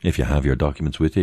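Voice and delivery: ordinary delivery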